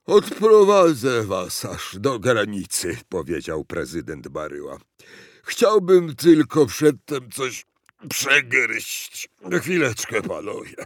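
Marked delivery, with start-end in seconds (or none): 0.0-3.0 loud, ponderous character voice; fat, sluggish president. A narrator's reading. President offers to escort visitors, ponderous. 5.4-10.8 loud, ponderous character voice; fat, sluggish president. A narrator's reading. President offers to escort visitors, ponderous.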